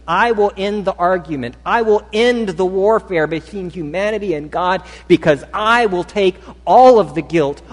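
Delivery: Jesus voiced by the preacher, building, resolute; resolute declaration. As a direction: proclaim it with growing force.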